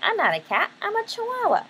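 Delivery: lightly voiced kitten, leaping high at the end; insistent kitten. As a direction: insist on who you are, pitch shooting up. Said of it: "So he's like".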